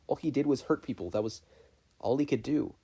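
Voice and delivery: usual speaking voice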